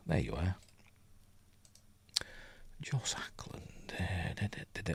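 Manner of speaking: barely audible musing while searching; absent-minded whisper. Real, not acted. Muttering while searching for information.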